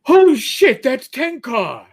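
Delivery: high, loud mock exclamation; excited fan. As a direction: imitate a fan suddenly recognizing someone. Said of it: "all of a sudden I hear".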